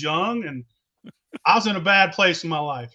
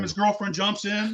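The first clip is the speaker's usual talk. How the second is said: normal talking voice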